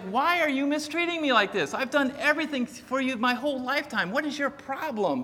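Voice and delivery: high-pitched, pleading complaint; aggrieved donkey. Retold or imitated. Donkey talking back to the man who beats her, aggrieved. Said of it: "But she's talking to him".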